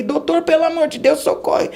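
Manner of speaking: high, pleading, frantic; begging the doctor to save her child; desperate plea. Retold or imitated. Mother bursting in on the pediatrician, frantic plea. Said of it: "eu falei".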